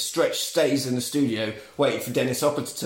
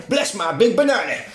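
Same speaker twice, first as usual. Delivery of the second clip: put-on higher-pitched, giddy old shopkeeper voice; excited old man; 6 semitones higher, 3 dB louder than usual. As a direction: exclaim it like a thrilled old codger. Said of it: "It's getting really excited".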